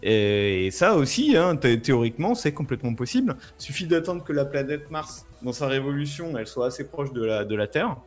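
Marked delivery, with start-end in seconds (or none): none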